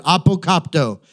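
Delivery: apokopto said clearly as ah-poh-KOP-toh; Greek word apokopto. Shown as a demonstration. Preacher teaching the congregation a Greek word, careful pronunciation. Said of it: "Don't forget this word".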